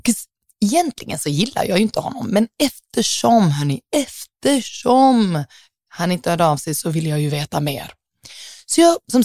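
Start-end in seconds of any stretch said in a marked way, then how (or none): 2.3-5.4 drawn out. Real, not acted. Drar ut ordet för effekt.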